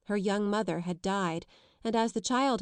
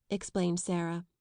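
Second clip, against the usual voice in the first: plain reading voice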